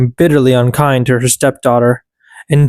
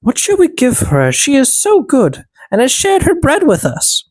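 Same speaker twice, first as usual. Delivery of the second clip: higher elf voices; elves conferring; 10 semitones higher than usual. A narrator's reading. Elves deciding her gift, higher voices. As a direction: wonder aloud, pitched up and gentle. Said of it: "the little men said".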